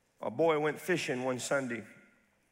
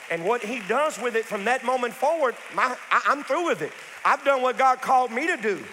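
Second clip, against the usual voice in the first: preacher gathering steam, a bit louder; testifying with growing force; rising preaching; 7 semitones higher, 7 dB louder than usual. Real, not acted. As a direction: start pushing the sermon up. Sermon building to full force.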